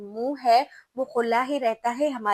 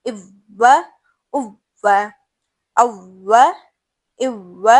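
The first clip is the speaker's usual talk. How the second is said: each harakat chanted slowly and loudly, with pauses between; slow, loud Qur'anic spelling drill; slow recitation drill; 7 dB louder, slower than usual. Shown as a demonstration. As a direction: spell out each vowel mark like a teacher drilling a class. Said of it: "حمزہ کی مدد لے کر اس واو کو شد کے ساتھ پڑھتے ہیں".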